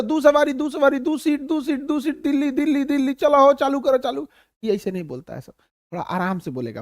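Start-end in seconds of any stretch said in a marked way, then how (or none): 0.0-4.3 ऊँची आवाज़ में टेम्पू वाले की सवारी पुकार; सड़क पर सवारी बुलाते टेम्पू वाले जैसे; टेम्पू वाला पुकार. Retold or imitated. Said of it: "अब से टेम्पू वाला कैसे". (बिहारी टेम्पू वाला ज़ोर से सवारी बुलाता)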